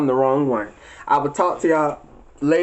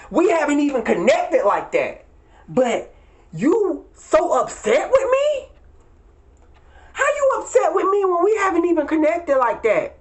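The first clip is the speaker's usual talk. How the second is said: high, sneering disbelief at an ex; incredulous mock retort; 10 semitones higher than usual. Mocking the narcissist directly.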